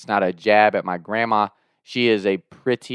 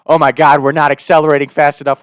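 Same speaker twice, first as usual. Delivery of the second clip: louder panicked retelling; retold scream; 3 semitones higher, 6 dB louder than usual. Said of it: "I'm screaming".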